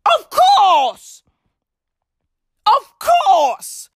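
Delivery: high eager of course; high emphatic exclamation; excited exclaim. Acted. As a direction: burst out agreeing. Enthusiastic agreement.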